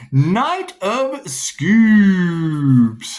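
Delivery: high, loud, very slow; intoned card name. Acted. Announcing the drawn tarot card, slow and grand.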